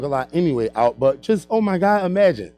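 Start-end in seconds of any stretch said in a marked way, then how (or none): none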